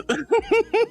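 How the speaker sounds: a burst of high giggling; squeaky giggle. Real, not acted. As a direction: giggle up high, caught off guard. Laughing on stream.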